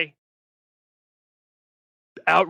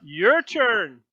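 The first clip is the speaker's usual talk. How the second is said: half-elf show host; 2 semitones higher, 5 dB louder, much slower than usual. Acted. Half-elf host calls next contestant.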